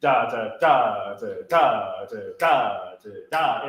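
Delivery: low, swung DA DA syllables; hard swing rhythm. Shown as a demonstration. Director showing the choir two measures of hard swing. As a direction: bounce DA DA in a lilting long-short beat.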